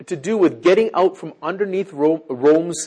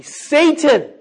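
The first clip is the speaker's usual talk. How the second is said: sudden high, loud cry of rebuke; stern rebuke; 12 semitones higher, 8 dB louder than usual. Acted. Jesus looking Peter straight in the eye, loud rebuke. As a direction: burst out on the name, high and loud.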